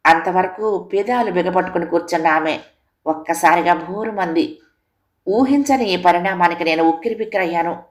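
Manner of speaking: ordinary delivery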